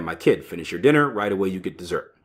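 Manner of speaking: ordinary talk, nothing marked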